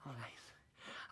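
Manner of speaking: low unvoiced resigned whisper. Husband about to list his miseries to his friend, whispering. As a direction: give in under your breath. Sinking before telling his woes.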